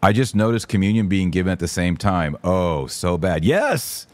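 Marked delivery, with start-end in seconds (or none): none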